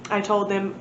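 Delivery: ordinary delivery, nothing marked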